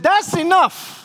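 high, sharp imitation of a wife's outburst; fed-up outburst. Fed-up wife puts foot down.

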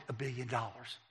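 low, soft, matter-of-fact statement; understated retold admission; quiet disclosure. Wife calmly states the amount.

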